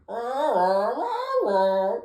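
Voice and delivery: high, loud creature noise, rah after rah; magical Liopleurodon's cry. Acted. Acting out what the magical Liopleurodon said, high creature cry. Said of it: "the magical Leo Pleurodon said to me".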